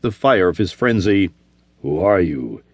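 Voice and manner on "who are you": lower, curt barbarian question; lightly demanding warrior. Acted. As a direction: demand it bluntly. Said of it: "he demanded".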